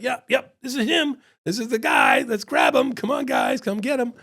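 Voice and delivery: high, urgent mock call to move in; urgent signalling. Waiter covertly signalling the cop to grab him, high and urgent. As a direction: urge them on in a high, strained voice.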